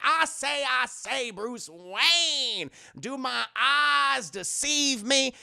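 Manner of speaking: put-on loud, high, blustering Southern accent; Foghorn Leghorn. Rossum hailing Bruce Wayne, blustering thick Southern accent. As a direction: boom it out in a hammed-up Southern drawl. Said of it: "He's like Foghorn Leghorn".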